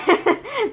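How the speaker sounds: high squealing laugh; mocking laugh. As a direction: burst into a squeaky gleeful laugh. Hype man laughs at the joke.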